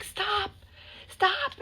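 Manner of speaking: high-pitched imitated yelling; mock yell. Imitating drunk people yelling in the street. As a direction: shout it up high like drunks outside. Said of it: "I just heard people yelling".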